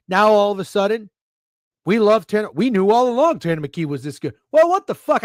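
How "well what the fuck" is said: higher and louder, fed up; exasperated outburst. Real, not acted. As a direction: blurt it out in disbelief.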